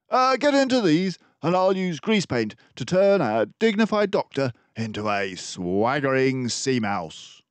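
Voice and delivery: jaunty sea captain. Acted. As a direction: toss out orders with a twinkle. Disguised detective, jaunty.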